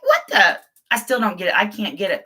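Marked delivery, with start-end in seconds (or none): 0.0-0.6 high-pitched baffled reaction; puzzled, pitched way up; mock bafflement. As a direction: squeak it out in mock disbelief. Acting out her baffled reaction.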